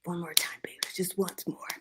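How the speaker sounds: voiceless pleading whisper; begging whisper. A narrator's reading. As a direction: whisper it as a plea. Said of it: "he begged".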